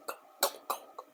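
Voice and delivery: soft unvoiced go go go; whispered shooing. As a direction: hush them out quickly. Shooing the voices away.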